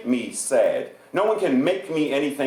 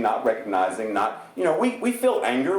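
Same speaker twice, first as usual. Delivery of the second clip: ordinary talk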